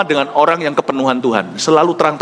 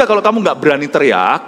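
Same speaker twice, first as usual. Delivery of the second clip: ordinary delivery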